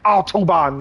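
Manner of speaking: mock harsh Autobahn, vowels clipped; imitating Germans who make Autobahn sound painful; harsh German accent. Mocking thick-accented Germans saying Autobahn. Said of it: "They literally say it like".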